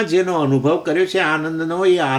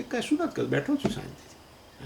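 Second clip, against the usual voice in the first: serene quiet reply; 8 dB quieter than usual. Retold or imitated. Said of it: "આનું નામ ઉદાસીન અવસ્થા".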